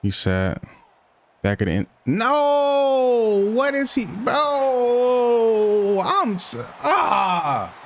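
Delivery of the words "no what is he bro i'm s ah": real rage at a blown play; frustrated yell. Real, not acted. Receiver drops it in end zone.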